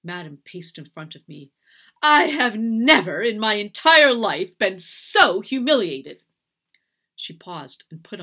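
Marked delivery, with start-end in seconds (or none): 2.0-6.2 loud, slow, indignant tirade; higher, louder wounded outrage; outraged. A narrator's reading. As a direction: hammer each word with fury. Furious mistress rages at servant.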